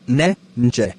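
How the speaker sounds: "ne, nje" sounded out slowly as isolated syllables; sound demo. Shown as a demonstration. Teacher to students, sounding out "ne, nje" as isolated syllables. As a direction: voice the sounds "ne, nje" one by one, set apart from the sentence. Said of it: "The sounds is me".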